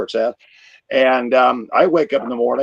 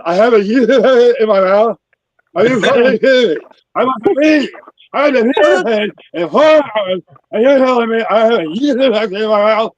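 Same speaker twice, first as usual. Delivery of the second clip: loud, high swollen-tongue outrage; outraged disbelief; 10 semitones higher, 7 dB louder than usual. Retold or imitated. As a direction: rage at the doctor's news. Outraged retold reply to doctor.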